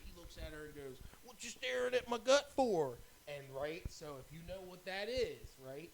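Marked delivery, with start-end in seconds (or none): 1.2-3.0 slow, high, loud mock-indignant line; Trailer Park Boys quote. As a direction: belt out the show's line, slowed down. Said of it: "That's Trailer Park Boys".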